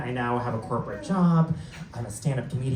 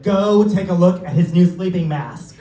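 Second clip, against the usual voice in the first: louder, laughing instruction; amused purser; 4 semitones higher, 7 dB louder than usual. Retold or imitated. Purser telling him to check the passenger, louder and laughing. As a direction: tell him to go look. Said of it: "She's like".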